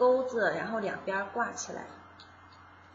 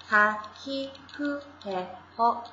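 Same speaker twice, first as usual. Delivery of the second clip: '哈嘿呼嘿吼' recited slowly, each sound set apart; slow recital of the row; slower than usual. Shown as a demonstration. Teacher reciting the ha row slowly for students. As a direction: spell out '哈嘿呼嘿吼' syllable by syllable, slow. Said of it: "是哈行".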